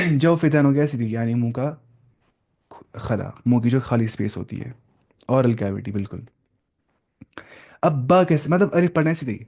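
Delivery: ordinary delivery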